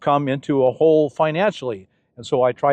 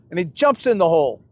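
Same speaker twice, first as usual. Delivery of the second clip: ordinary talk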